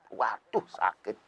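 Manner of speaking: mock pain. Holding in urine hurts.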